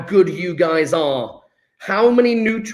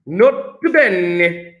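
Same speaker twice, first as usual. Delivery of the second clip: slow, drawn-out Italian accent; hammed-up Italian accent; much slower than usual. Shown as a demonstration. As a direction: roll it out slowly as a mock Italian. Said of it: "I'm saying it with an Italian accent".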